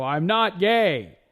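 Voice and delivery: high, loud and slow shout; yelled denial. Retold or imitated. At the party, announcing to everyone, a loud slow yell. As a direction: bellow it to the whole party. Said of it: "I yell, I go".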